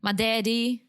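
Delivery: a quick Southern impression, barely put on; Southern accent. Joking about a Southern dad, light Southern accent. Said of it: "That's my Southern accent".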